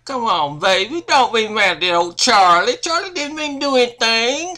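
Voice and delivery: butter up the angry wife; coaxing sweet talk. Acted. Charlie sweet-talking his wife, loud and pleading.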